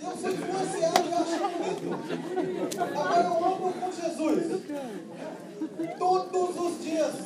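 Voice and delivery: fervent preaching. Acted. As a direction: declare it like a preacher at full force. Preaches on walking with Jesus.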